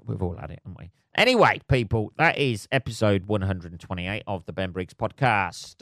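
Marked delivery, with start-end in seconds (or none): 0.0-0.9 much softer, lower repeat of We've all had it; quieter repeat. Real, not acted. Wry aside to listeners, much softer repeat. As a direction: say We've all had it again under your breath.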